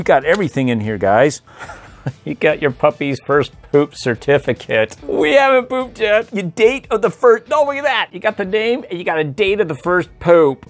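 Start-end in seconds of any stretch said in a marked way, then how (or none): 5.0-10.7 high cartoon pup voice, amazed; much higher toy-dog voice, delighted; squeaky puppy voice. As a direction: read it like a tiny delighted puppy. Squeaky voice reading the certificate.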